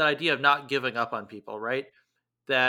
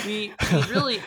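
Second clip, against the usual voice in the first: laughing; 6 semitones higher, 4 dB louder than usual. Real, not acted. Both laughing over old quarrel.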